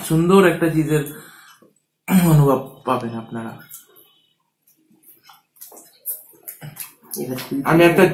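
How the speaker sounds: words muffled by chewing; talking while chewing. Real, not acted. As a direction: mouth partly full of food. Seen 2: Just bit pizza at -5; lips mostly closed, chewing while speaking.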